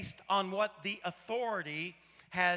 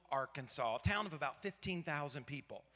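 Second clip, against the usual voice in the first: ordinary talk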